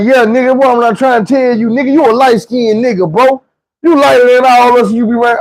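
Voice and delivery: high-pitched, emphatic argument; heated insistence. Retold or imitated. Light-skinned guy arguing his point, heated insistence. Acts out the man arguing back.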